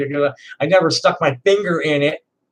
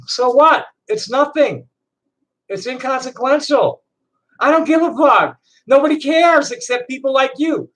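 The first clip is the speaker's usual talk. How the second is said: angry outburst; 8 semitones higher than usual. Real, not acted. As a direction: snap back hard at the critics. Angry rant at critics.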